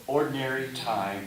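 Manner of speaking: ordinary delivery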